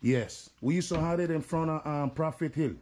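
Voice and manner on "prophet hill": ordinary delivery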